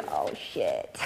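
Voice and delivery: low, dropped mock dismay; deadpan thought. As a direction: mutter it under a laugh. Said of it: "thought bubble which goes".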